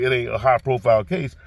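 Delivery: ordinary delivery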